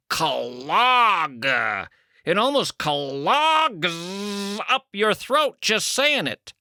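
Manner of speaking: Clog! dragged out as kh-uh-log, slow and throaty. Shown as a demonstration. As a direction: choke the word Clog! in your throat. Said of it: "it almost comes off as three syllables".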